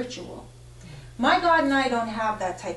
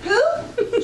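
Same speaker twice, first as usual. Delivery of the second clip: high, loud incredulous who; baffled who; 14 semitones higher, 7 dB louder than usual. Retold or imitated. She hears of Saint Jude for the first time, shocked and high. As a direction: squeak it out in total disbelief. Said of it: "So I said".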